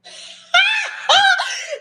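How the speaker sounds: high shrieking burst of laughter. Real, not acted. Laughing in disbelief at being told to leave, shrieking laugh.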